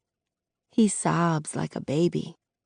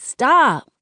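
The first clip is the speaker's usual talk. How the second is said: a higher, pleading stop; 8 semitones higher, 4 dB louder than usual. Acted. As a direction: beg him to stop. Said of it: "Kenya pleads".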